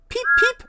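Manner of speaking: very high, loud peeping whistle. A narrator's reading. Thomas answering the guard's whistle, peeping eagerly. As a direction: toot like an eager engine. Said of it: "answered Thomas".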